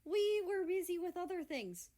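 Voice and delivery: high, loud protest. A narrator's reading. Russia to Germany, loud defensive protest. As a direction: defend himself loudly. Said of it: "Russia protests".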